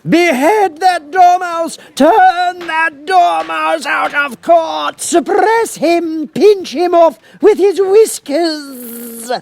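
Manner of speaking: very high, loud, slow shriek; shrieking Queen. A narrator's reading. Queen shrieks at her officers about the dormouse. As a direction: scream the order at the court. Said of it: "the Queen shrieked out".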